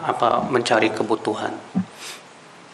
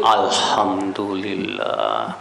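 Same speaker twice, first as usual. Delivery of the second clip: drawn-out alhamdulillah; 6 semitones lower, much slower than usual. Retold or imitated. Mocking people thanking for wealth.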